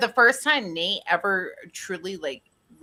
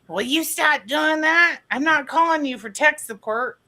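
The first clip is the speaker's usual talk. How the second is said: angry boss; 10 semitones higher, 6 dB louder than usual. Retold or imitated. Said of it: "his boss gets so mad".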